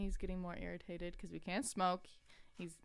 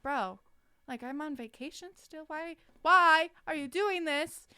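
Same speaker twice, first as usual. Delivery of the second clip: annoyed employee complaining to her boss, loud exasperated whine; irritated retold complaint; 7 semitones higher, 7 dB louder than usual. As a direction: whine at the boss for calling on vacation. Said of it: "I was so mad and irritated".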